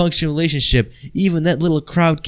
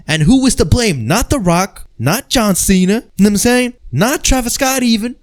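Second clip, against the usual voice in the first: ranting; 9 semitones higher, 4 dB louder than usual. Real, not acted. Ranting build to the blame.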